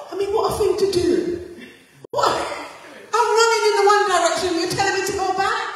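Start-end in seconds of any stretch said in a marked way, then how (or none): 2.1-5.7 higher, fast, louder disbelief; can't believe he's told to go back; incredulous Elijah. Acted. Elijah protesting God's order to turn back, incredulous.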